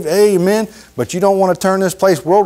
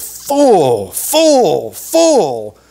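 drawn-out; 3 semitones higher, much slower than usual. Acted. Preacher drawing out "full.

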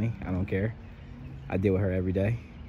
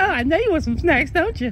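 put-on very high, loud baby-talk tease; sing-song teasing of wife; 10 dB louder than usual. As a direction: coax her like a child.

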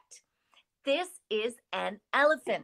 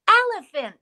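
sprung elephant word; 9 semitones higher, 9 dB louder than usual. Shown as a demonstration. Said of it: "This is an elephant".

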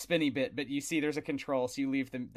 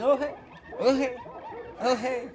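high, loud repeated doggy greeting; excitable dog hailing someone passing by; 12 semitones higher, 7 dB louder than usual. Acted. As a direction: pipe it up afresh at each pass. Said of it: "their heads following each time you pass, saying".

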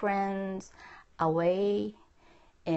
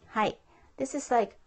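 ordinary delivery

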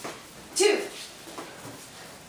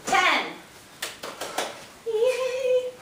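ordinary delivery

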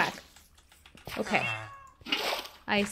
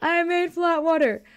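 laughing; 5 semitones higher than usual. Real, not acted. Repeats it, laughing.

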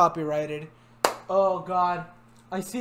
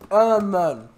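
cheeks stuffed full; 7 dB louder than usual. Real, not acted. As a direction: mouth crammed with candy. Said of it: "I'm gonna join the challenge".

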